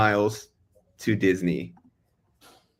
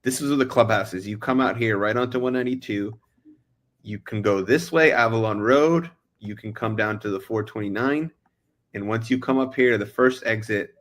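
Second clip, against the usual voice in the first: ordinary talk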